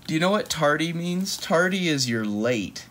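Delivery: plain reading voice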